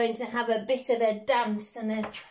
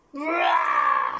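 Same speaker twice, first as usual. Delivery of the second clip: put-on low monster roar for the child; playful roar; 7 dB louder than usual. Parent roaring as a wild thing for the child, low and playful.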